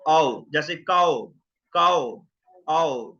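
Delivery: "आओ" said slowly as a clear a-to-o glide; au diphthong. Shown as a demonstration. Teacher showing students the au sound, slow. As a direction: stretch out "आओ" like cow. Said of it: "जिसे हम आओ बोलते हैं".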